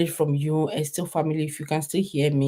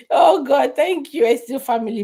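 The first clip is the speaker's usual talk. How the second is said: laughing; 7 semitones higher, 7 dB louder than usual. Real, not acted. Laughs, thanks viewers.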